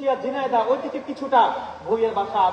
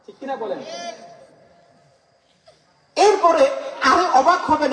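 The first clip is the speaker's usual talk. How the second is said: usual speaking voice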